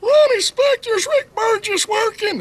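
high, slow, breathy squeak; boss's high-pitched voice. Retold or imitated. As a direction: stretch it out high and wheezy. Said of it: "Had a real high-pitched voice".

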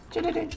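tiny squeaky imitated chirp; high chirp. Shown as a demonstration. Mimics high chirp sound.

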